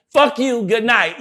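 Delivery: defiant sign-off. Retold or imitated. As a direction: fling it at the cops. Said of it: "and say".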